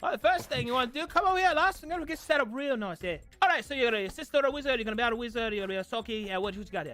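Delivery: put-on fast, high Pikish accent. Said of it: "That was me doing a Pikish accent".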